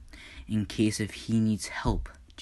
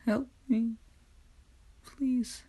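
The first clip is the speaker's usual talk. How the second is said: put-on much higher, small child's plea for help; pleading child; 14 semitones higher, slower than usual. A narrator's reading. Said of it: "the kid just says".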